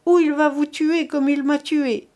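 a dying woman's strained plea; pleading with her last strength; faint dying voice. A narrator's reading. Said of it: "d'une voix éteinte". (voix éteinte de mourante)

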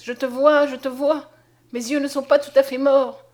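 overjoyed. A narrator's reading. Conscience to Mariette, realizing he can see, elated. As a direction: announce the miracle, elated. Blind man suddenly sees again.

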